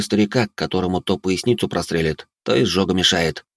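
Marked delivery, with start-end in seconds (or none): none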